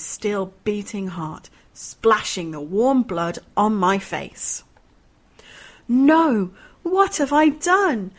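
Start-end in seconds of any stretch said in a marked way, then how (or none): 5.9-8.1 high, loud, slow anguished wail; horrified cry. Acted. Mother realising she stabbed her son, horrified loud cry. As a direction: cry out in horror.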